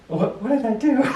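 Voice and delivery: high, laughing mock confusion; mock baffled laugh. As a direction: act baffled while laughing. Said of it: "And I was like".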